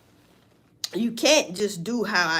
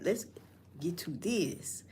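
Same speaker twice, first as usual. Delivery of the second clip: slow breathy whisper of the catchphrase; 3 semitones lower, 11 dB quieter, slower than usual. Shown as a demonstration. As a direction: whisper it, drawn out. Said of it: "See, I used to say like that".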